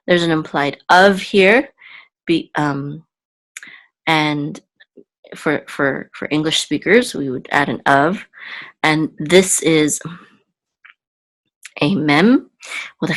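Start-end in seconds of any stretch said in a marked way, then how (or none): none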